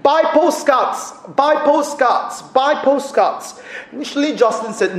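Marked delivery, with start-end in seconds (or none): 0.0-3.6 higher, slow, insistent broken-English plea; pleading street vendor. Retold or imitated. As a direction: beg like a pushy old vendor, slowly and high. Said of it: "in her broken English, she would plead".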